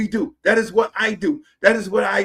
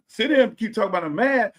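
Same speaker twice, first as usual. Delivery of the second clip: usual speaking voice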